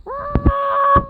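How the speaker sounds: long, high mock scream. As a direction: hold a shriek of play terror. Playing at disaster, screaming.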